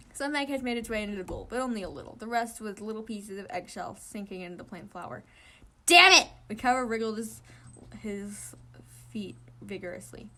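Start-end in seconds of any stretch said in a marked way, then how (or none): 5.9-6.3 loud high curse; angry shout. Acted. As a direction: yell it in frustration. Egg spills on shoes.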